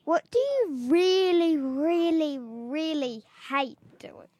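"really" stretched out three times; emphatic stress. Real, not acted. Child host pressing a friend for an answer, "really" drawn out emphatically. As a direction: lean hard on each "really", slowing into "hate". Pressing for the least favourite thing.

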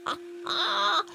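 high cackling chortle; gleeful chortle. A narrator's reading. Henry chortling about his parents' messy room, high gleeful chuckle. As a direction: chuckle wickedly at the coming shock. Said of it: "Chortled Henry".

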